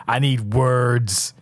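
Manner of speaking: slow, drawn-out mimicry; mock catchphrase. Mocking the champion's catchphrase, very drawn out. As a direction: stretch it out like a catchphrase.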